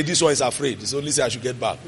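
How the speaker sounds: low, soft voice for a dog; dog's private thought. Acted. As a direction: think it aloud quietly as the dog.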